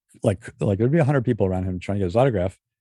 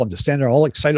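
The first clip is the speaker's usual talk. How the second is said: ordinary delivery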